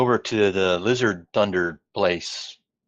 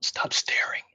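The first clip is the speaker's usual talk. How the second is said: whispered aside to a companion; hushed, secretive reprimand; in-character whisper; 5 dB quieter than usual. Pretend. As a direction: whisper it as a discreet warning. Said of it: "I whisper over to Cumberbatch".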